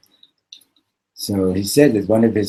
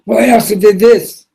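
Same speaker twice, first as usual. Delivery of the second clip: much higher and louder, pleading; desperate confession; 12 semitones higher, 11 dB louder than usual. Retold or imitated. Preacher confessing to the axe man, high frantic pleading. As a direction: plead your sins in a panic.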